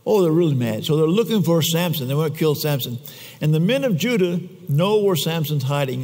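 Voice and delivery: ordinary delivery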